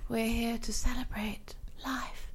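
slow, soft serene whisper; cult whisper. Acted. Cult member welcoming lost children, serene whisper. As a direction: breathe it like a blissed-out cult member.

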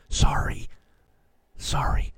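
breathy mock apology, barely voiced; whispered sorry. Real, not acted. Pastor jokingly whispers an apology to his listeners. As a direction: whisper it like a guilty aside. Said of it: "jokey apology".

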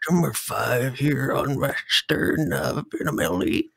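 put-on decrepit old caller, wavering pitch; Macho in assisted living. Hobbyist phoning for pinball parts, hammed-up old-man voice. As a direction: do a hammed-up elderly caller with a breaking voice. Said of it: "like Macho calling from assisted living".

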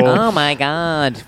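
slow, drawn-out nasal "Oh my God"; nasal mock moan. Mocking the sex worker's fake moan, drawn out and nasal. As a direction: stretch it through the nose, mocking. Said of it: "extremely nasally".

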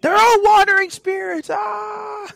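high, loud theatrical yell; mock shout. Revealing the spirits to friends, mock horrified shout. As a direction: cry it out high and hammed-up, ending in a wail. Acted outburst about the spirits.

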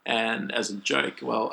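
usual speaking voice

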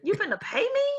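high, slow, amazed voice that breaks into laughter; incredulous excitement. Retold or imitated. Student at job fair hearing salary, amazed and laughing. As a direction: pitch way up, disbelieving and thrilled. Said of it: "So I was like".

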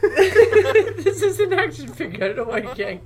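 loud, high, laughed-out words; laughing protest. Real, not acted. Friend reacting to the tape, cracking up. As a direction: say it through a burst of laughter.

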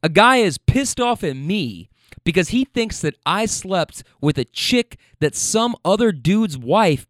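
usual speaking voice

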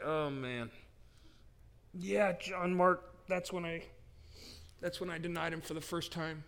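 slightly subdued, halting admission; reluctant confession. Acted. Voices Peter reluctantly confessing.

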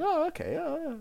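squeaky high "oh, okay"; high mock realisation. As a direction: mimic a dim listener catching on. Mimicking listeners' dawning realisation.

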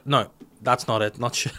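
ordinary talk

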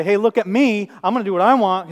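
loud, high swaggering boast; hammed-up machismo, shouted out; macho bravado. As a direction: show off with mock bravado. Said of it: "His bravado, his machismo".

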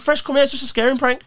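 high-pitched call to a mate. Retold or imitated. Calling Fresh over in front of police, retold calling out.

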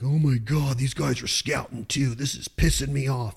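lightly whiny imitation of griping; mock annoyance. Rival hunter gripes about others scouting, mock annoyed. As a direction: complain like a put-out rival, half in earnest. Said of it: "Don't be like".

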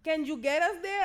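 mock Spanish-accented question. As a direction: plead in a light Spanish accent. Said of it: "This is all in a Spanish accent".

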